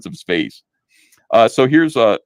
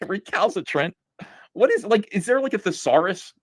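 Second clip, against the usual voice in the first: incredulous; 8 semitones higher than usual. Real, not acted.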